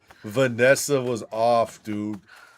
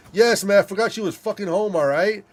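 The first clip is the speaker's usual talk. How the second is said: defensive; 8 semitones higher than usual. Retold or imitated. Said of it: "I was like".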